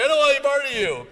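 loud, high, rushed recoil; mock alarm. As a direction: imitate a crowd backing off in a hurry. Crowd recoiling, acted.

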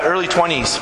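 ordinary delivery

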